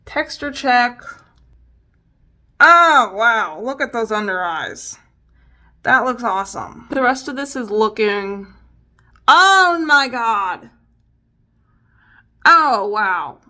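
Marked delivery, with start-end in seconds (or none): 2.6-3.6 surprised. Real, not acted. Texture check. 9.3-13.4 slow, loud oh my God; loud dismayed exclamation. Real, not acted. Vlogger checking makeup texture, loudly dismayed.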